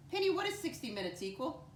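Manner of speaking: high-pitched question with rising end; coaxing question. Pretend. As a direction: ask Penny in a high, lilting voice. Teacher turns to ask Penny.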